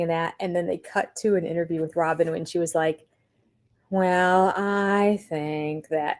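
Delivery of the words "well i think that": slow, droopy mopey voice; slow gloomy Eeyore drawl; Eeyore impression. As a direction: mope it out like Eeyore. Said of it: "so classic Eeyore".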